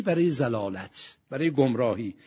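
ordinary delivery

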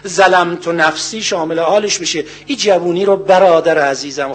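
ordinary talk

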